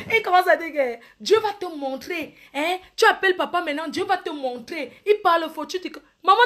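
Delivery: ordinary delivery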